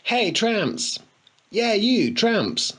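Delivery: high, mocking shout at the tramps; taunting call. A narrator's reading. Spoilt boy jeering at tramps through a hedge, mocking and high. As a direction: jeer at them from behind the bush.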